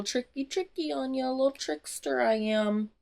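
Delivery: high, sing-song mock taunt; playful taunting. Legend teases Scarlett about his trick, playfully taunting. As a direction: toy with the listener, lilting upward.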